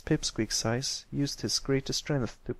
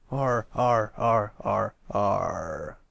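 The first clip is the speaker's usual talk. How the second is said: louder, falling arr arr pirate laugh; deep pirate laugh; 3 semitones lower, 4 dB louder, much slower than usual. A narrator's reading. Patch laughing as Captain Ironhoof, deep and gloating. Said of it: "let out a deep laugh to emulate".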